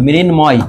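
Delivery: মৃন্ময় slowly split as "mrin-moy"; slow teacher demo of মৃন্ময়, both syllables clear; m kept audible. Shown as a demonstration. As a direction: sound out the m in মৃন্ময়. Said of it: "মাঝে আছে, এই জন্য বহাল থাকবে".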